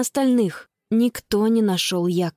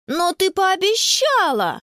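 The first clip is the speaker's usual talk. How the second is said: high, drawn-out whine of protest; protesting child; 9 semitones higher, slower than usual. A narrator's reading. Little brother holding his sister to a promise, protesting. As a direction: wail it slowly as a let-down little boy.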